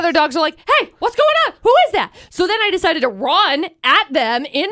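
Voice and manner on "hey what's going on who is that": much higher, louder alarmed dog voices; high squeaky dog voices. Retold or imitated. Owner voicing her dogs alarmed by the ghost, high squeaky voices. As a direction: squeal like startled little dogs.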